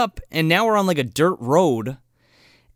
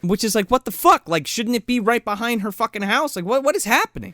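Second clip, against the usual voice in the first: high, disbelieving what the fuck; rising baffled outrage; incredulous; 7 semitones higher than usual. Real, not acted. Incredulous rant.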